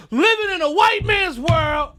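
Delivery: high, loud, fired-up yell; impassioned holler; ranting shout. Real, not acted. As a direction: shout it out as the rant peaks. Angry rant peaks.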